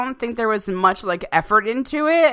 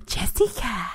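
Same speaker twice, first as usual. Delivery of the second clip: breathy whispered doll call; creepy doll whisper; 5 dB quieter than usual. Retold or imitated. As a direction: whisper the name like a haunted doll. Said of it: "you guys heard of that trailer".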